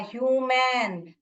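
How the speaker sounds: ह्यूमन slowly, as "hyoo-men"; long U in ह्यूमन. Shown as a demonstration. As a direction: draw out the first syllable of ह्यूमन. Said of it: "यू करके बोल रहे हैं हम".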